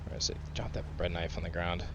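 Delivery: quiet mutter while searching; muttered under breath. Real, not acted. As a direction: mutter it to yourself. Muttering to himself.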